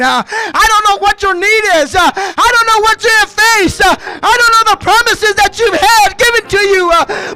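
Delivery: loud, rising preacher's cry with "uh" breaths; full-force preaching. Acted. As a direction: preach it out, building to a shout.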